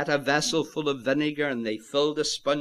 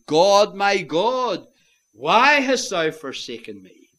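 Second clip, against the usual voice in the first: loud, anguished cry from the pulpit; raised, impassioned plea; 6 semitones higher, 7 dB louder than usual. Retold or imitated. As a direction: cry it out at full force. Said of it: "he cries out".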